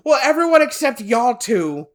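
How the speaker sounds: high, loud mock of the boss; mock retort. Boss correcting me about the trip, high and loud. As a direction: throw back his comeback.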